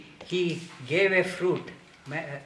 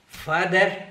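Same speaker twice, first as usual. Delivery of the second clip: usual speaking voice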